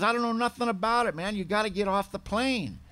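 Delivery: lightly acted fed-up airline lady; imitated impatient attendant. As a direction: act out brushing off a clueless passenger. Attendant losing patience, retold.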